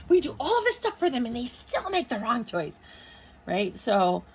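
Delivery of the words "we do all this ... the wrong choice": high, loud comic complaint; hammed-up exasperation, pitched way up; exasperated angels. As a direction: protest in mock disbelief. Angels' acted complaint about humans.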